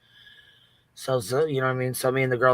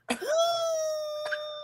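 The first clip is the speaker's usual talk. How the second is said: high, thin whistling wheeze; drawn-out squealing mock snore; imitated snore wheeze; 8 dB quieter than usual. Showing exactly what her sleeping wheeze sounds like, mock wheeze. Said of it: "that's exactly what it sounds like".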